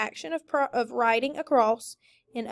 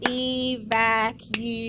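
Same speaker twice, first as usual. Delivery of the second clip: slow, one beat per syllable, e-vac-u-ate; clapped syllables; much slower than usual. Shown as a demonstration. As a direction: clap through Evacuate as e-vac-u-ate. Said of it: "Clap it".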